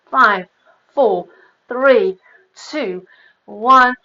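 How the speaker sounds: each number called out loud and punchy; coach countdown. Real, not acted. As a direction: count them down loud to drive the class. Fitness coach counting down reps.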